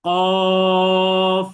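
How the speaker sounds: loud drawn-out কফ for the class to repeat; সবাইকে শেখাতে টেনে কফ; model qaf. Shown as a demonstration. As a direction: stretch কফ long and heavy from the tongue root. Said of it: "এইভাবে উচ্চারণ করার চেষ্টা করি".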